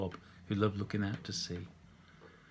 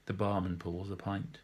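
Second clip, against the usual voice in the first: plain reading voice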